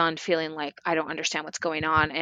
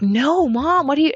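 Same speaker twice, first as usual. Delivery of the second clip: mock cry of protest, pitched up and louder; retold loud protest; 7 semitones higher, 6 dB louder than usual. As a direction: blurt out no to mom in disbelief.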